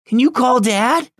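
put-on high, childlike pleading; frightened voice. Little brother asks his sister to call Dad, high frightened voice. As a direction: plead like a scared little boy. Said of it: "said in a frightened voice".

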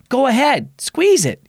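high eager dare; daring. Acted. Brother dares sister to squeeze the nutcracker, eager and high. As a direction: egg her on to squeeze.

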